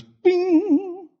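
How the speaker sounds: very high, drawn-out "bing"; imitated megaphone sound. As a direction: sound out a long electronic ping. Imitating the street preacher's megaphone sound.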